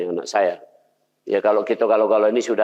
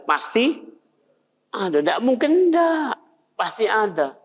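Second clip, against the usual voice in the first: high, slow, insisting; emphatic insistence; 9 semitones higher, slower than usual. Real, not acted. Preacher insisting to the class that criminals have good in them. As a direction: drive it home, pitched way up. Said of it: "insists every criminal has good".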